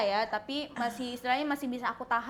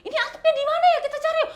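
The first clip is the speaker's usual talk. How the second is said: high, louder, eager re-enacted plea; seru mengajak; 13 semitones higher, 7 dB louder, faster than usual. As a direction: act out an excited search. Acted urgent search in mall. (ajakan heboh bernada tinggi)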